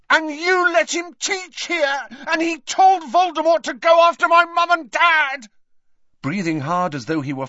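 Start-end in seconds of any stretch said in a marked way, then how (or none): 0.1-5.5 high, furious full-out shout; uncontrolled yelling. Acted. Harry blaming Dumbledore for his parents, furious yell. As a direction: lose control and yell the accusation. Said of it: "suddenly he could not stop himself".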